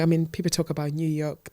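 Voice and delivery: normal talking voice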